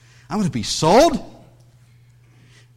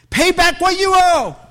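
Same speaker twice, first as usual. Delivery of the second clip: high, loud, snarling demand; angry demand; 11 semitones higher, 6 dB louder than usual. Acted. Creditor choking the fellow slave, roaring demand. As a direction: bark it at him like a choking creditor. Seized him and began to choke him.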